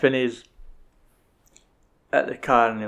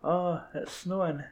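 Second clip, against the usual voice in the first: slow, soft, high wonder; dreamy mock wonder; 7 semitones higher, 9 dB quieter, slower than usual. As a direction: marvel slowly like a dazed character. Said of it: "it's like".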